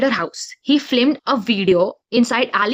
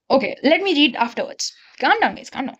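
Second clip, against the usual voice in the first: normal talking voice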